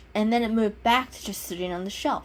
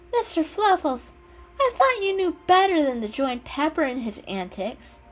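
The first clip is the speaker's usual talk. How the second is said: higher, reproachful scolding voice; disappointed owner; 8 semitones higher than usual. A narrator's reading. Owner scolding the kitten for joining in, reproachful. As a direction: tell him off gently but let down.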